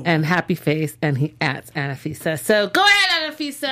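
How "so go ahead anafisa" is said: high excited cheer; cheering on her love life, voice jumping up. Real, not acted. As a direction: hype her up. Cheering on Anafisa.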